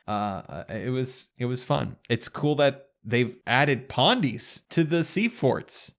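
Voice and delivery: usual speaking voice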